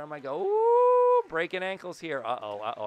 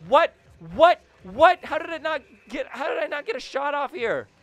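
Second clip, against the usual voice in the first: higher, louder stunned disbelief; 12 semitones higher, 10 dB louder than usual. Real, not acted. Gamer stunned at not getting a shot off, loud disbelief. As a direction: sound robbed of the chance. Missed chance in game.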